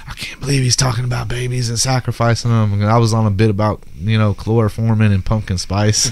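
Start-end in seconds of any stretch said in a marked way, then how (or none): 0.1-2.7 mock whisper, scandalized; offended whisper. Offended girl at a comedy show, mock whisper. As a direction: hiss it like a shocked audience member. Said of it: "she's like".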